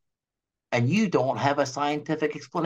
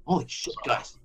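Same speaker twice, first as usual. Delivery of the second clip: panicked staffer; 4 dB quieter than usual. Pretend. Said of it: "Moderna freaks out".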